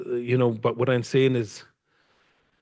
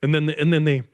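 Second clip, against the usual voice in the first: ordinary delivery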